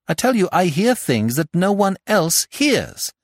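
high, urgent protest; desperate insistence. Acted. Jack telling the doctor about his delusions, high and agitated. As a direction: plead to be believed, pitch well up.

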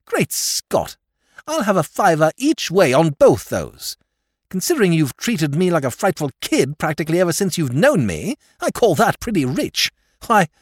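high, loud sarcastic protest; indignant. Acted. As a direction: throw it back at him scornfully. Nephew bitterly mocking his uncle.

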